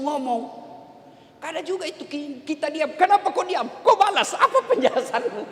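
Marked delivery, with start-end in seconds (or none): 3.0-5.4 higher, louder, demanding; nagging wife. Acted. Wife scolds her silent husband, demanding an answer loudly.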